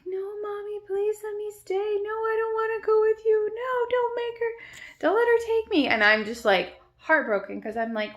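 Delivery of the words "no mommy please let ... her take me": put-on very high little-girl pleading; imitated screaming child. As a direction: imitate a desperate child begging, building louder. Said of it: "she's just screaming".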